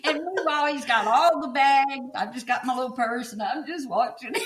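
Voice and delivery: high, laughing storytelling; real amusement. Real, not acted. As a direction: tell it through helpless laughter. Laughing through her own funny story.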